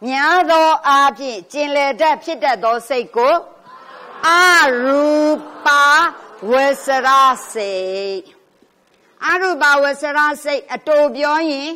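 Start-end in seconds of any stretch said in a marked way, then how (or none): 4.3-8.2 teacher breaking အရူပဝဆရစိတ် into separate syllables for students. Shown as a demonstration. Spelling out the term for the class. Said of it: "teacher spells term syllable by syllable".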